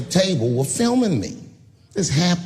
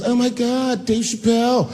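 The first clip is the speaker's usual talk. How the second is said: much higher starstruck squeal; mock excited fan; 7 semitones higher than usual. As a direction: gush like a fan spotting a star. Fan woman recognizing him.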